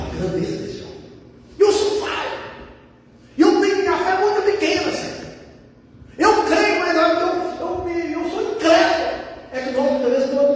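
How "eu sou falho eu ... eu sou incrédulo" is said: high, urgent voice of the father; pleading confession, acted. Father admitting weak faith to the Lord, acted pleading confession.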